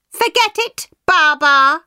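very high, cheeky squeak; cheeky pancake. Acted. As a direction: fling it off high and loud. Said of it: "the pancake said".

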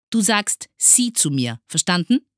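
loud, high, strict teacher; stern order. A narrator's reading. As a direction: lay down the rule sharply. Teacher sternly orders form of address. (streng und laut zurechtweisend)

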